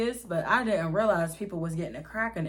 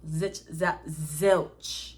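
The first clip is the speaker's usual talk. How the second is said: normal talking voice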